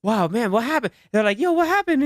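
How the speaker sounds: high, lightly voiced teammates; mock concern. Friend quoting teammates asking, mock concern. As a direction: toss it up high like teasing buddies.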